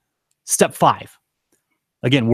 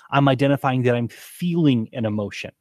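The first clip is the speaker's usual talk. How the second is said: usual speaking voice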